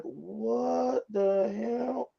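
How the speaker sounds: slow, stunned what the hell; drawn-out disbelieving gasp of a question; stunned at the sight; shocked disbelief. Retold or imitated. Shocked at seeing her cheat.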